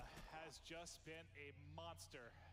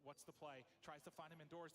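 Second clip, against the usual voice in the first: ordinary delivery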